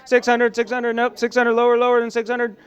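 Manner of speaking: fast, high, loud auctioneer chant. Pretend. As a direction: rattle off bids like an auctioneer. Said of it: "That's my auctioneer voice".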